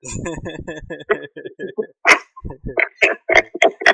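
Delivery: long, loud burst of laughter; high, loud, sustained laughing; hearty laugh. Real, not acted. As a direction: crack up loudly. Host cracks up at the reply.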